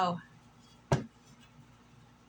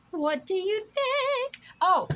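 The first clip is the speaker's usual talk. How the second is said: silly high voice; 11 semitones higher, 4 dB louder, slower than usual. Pretend. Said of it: "plain take first".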